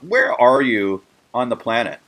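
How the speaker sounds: normal talking voice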